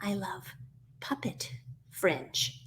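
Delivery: slow, soft reply with "Puppet" set apart; stress on PUPPET. Acted. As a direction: pause before "Puppet" and lean on it, quietly. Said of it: "What was that again?".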